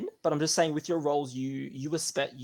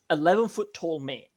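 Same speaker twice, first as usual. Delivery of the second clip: ordinary talk, nothing marked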